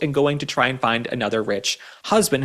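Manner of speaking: ordinary talk, nothing marked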